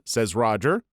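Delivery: ordinary delivery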